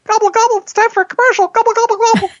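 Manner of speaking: very high turkey voice announcing a break; gobbling turkey voice. Pretend. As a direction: squeak it out like a cartoon turkey. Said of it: "The turkey is going to tell us".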